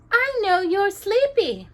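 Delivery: high, slow delighted voice; high slow happy princess voice; glad princess. A narrator's reading.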